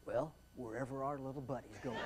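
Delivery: very soft, low captain voice; reply low and quiet over the radio; airline pilot. Retold or imitated. Jet pilot answering the controller, low-key.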